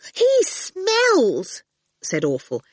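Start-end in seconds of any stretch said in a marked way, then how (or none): 0.1-1.6 put-on high, petulant child; a brat complaining; high sulky whine; whiny girl. A narrator's reading.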